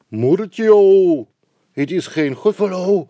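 high, loud frightened cry; terrified gasp. Acted. Mouse cries out at the Gruffalo, high and loud. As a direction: mouse shrieks at the monster.

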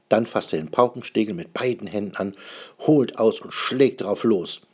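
ordinary delivery, nothing marked

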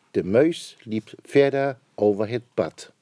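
plain reading voice

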